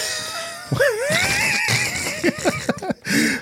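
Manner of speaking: shrieking laugh. Real, not acted. Cohost cracking up over the Irish mix-up, shrieking laugh.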